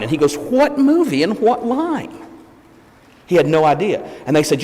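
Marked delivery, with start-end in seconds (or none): 0.4-2.2 high, slow puzzled question; baffled. Retold or imitated. Actor answering a fan on the street, baffled and high. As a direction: imitate someone utterly bewildered, pitched way up. Star baffled by fans' demand.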